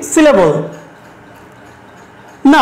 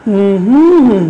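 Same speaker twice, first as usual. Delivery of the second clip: low closed-mouth hum with a rise and fall in the middle; hummed stress pattern; 4 semitones lower, faster than usual. Shown as a demonstration. Teacher shows students the stress of umbrella, hummed. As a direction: hum the melody of a three-syllable word, middle stressed. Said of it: "আমার সাথে করতে পারো এভাবে".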